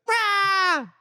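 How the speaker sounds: put-on high, loud roar of a child; hammed-up toddler yell; mock kid scream. Kids screaming at door.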